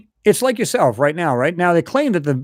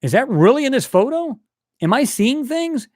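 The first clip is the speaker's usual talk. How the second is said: puzzled self-question; 9 semitones higher than usual. Retold or imitated. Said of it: "I go".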